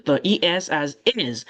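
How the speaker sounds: usual speaking voice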